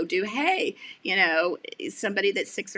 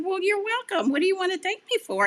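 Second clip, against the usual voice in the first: much higher, louder and fast, delighted question; delighted curiosity; 12 semitones higher, 4 dB louder, faster than usual. Retold or imitated. Host asking the grateful teen why, delighted and fast. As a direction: gush it out eagerly. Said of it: "And I said".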